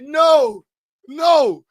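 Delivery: high, loud panicked no; horrified no. Acted. Reacting to a driver about to crash, high loud panic. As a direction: cry out no in alarm.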